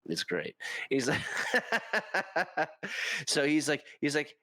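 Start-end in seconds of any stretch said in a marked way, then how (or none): 1.3-3.2 high-pitched run of laughter; laughing. Real, not acted. Storyteller amused by a streamer's character voice, laughing. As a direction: crack up mid-story.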